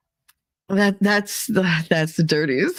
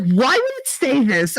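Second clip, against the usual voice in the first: retold incredulous question, pitched up; 6 semitones higher than usual. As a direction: mimic a friend's disbelief. Friend's shocked reaction to warning.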